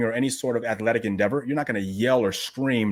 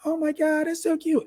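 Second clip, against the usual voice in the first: much higher, light cooing voice; baby talk. Shown as a demonstration. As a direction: coo at the baby in a lifted voice. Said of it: "You go".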